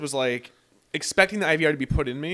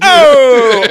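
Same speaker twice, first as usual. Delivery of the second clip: sharp yelp, much higher and louder; pained yelp; 11 semitones higher, 21 dB louder than usual. Retold or imitated. Patient yelping as the doctor's instrument goes in. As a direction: let out a sudden cry of pain. Procedure hurts, retelling his reaction.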